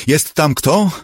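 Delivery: high, loud searching call. A narrator's reading. Ginny calls into the hatch for anyone inside, calling out. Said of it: "zawołała".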